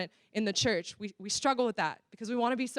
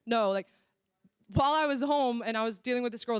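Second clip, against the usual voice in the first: normal talking voice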